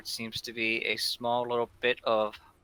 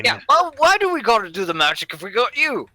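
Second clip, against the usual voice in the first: high bandit voice; 12 semitones higher, 9 dB louder than usual. Acted. Bandit questions magic.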